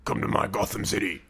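lightly put-on tough Batman voice; hard-man Batman. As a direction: play Batman acting well hard. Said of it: "when he's being well hard".

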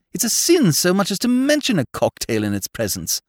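high, loud, indignant declaiming; emphatic. A narrator's reading. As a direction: pronounce judgement with great force. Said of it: "said Whimsy emphatically".

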